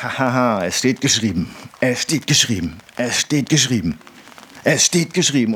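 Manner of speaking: a mild, confident taunt, then a firm refusal; defiant rebuke. Acted. Jesus answering the enemy in the desert, lightly defiant. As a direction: brush the enemy off with scripture. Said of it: "und du sagst".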